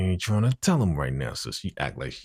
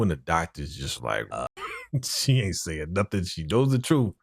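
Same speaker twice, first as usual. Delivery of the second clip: ordinary talk, nothing marked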